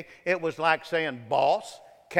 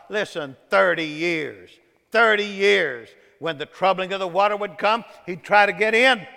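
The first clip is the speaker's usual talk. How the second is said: emphatic sermon storytelling, getting louder; full-force preaching; 4 semitones higher, 8 dB louder than usual. Acted. Preacher telling of the man by the pool, full-force preaching. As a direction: drive the repeated phrase home.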